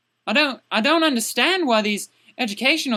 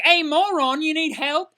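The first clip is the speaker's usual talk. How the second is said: high, insulting mock call; rude teacher; 5 semitones higher than usual. Shown as a demonstration. Teacher approaching a struggling student, rude and mocking. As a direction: demonstrate a tactless offer of help, pitched up. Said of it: "you don't go up to them and be like".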